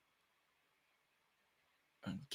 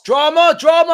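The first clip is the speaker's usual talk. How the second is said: much higher, much louder shout of drama; hyped shout at a ball in the box; 12 dB louder than usual. Real, not acted. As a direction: yell it out in hype. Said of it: "I'm shouting for no reason".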